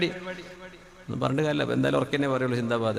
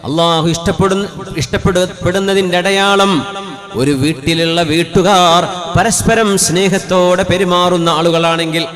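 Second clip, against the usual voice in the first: loud, higher, half-sung sermon chant; preaching at full force; 4 semitones higher, 8 dB louder, slower than usual. Acted. Preacher to the congregation on loving homes, loud sung preaching. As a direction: pour it out like a fervent preacher, drawing out the Arabic. Fervent sermon over music.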